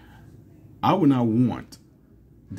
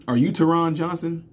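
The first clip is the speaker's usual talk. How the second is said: higher, incredulous check-in question; puzzled questioning; 7 semitones higher than usual. Retold or imitated. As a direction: ask it in puzzled disbelief. Acting out puzzled check-in lady.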